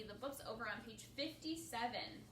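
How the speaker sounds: ordinary delivery, nothing marked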